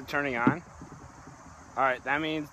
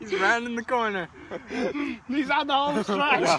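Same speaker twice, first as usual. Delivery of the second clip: laughing; 7 semitones higher, 4 dB louder than usual. Real, not acted. Commentating friend finishing challenge.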